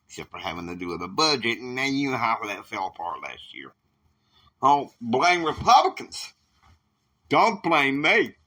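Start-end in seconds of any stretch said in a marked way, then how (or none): 4.6-8.3 louder, higher defensive retort; indignant, raised voice; heated retort. Acted. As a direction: throw the blame back forcefully. Heated quarrel with the president.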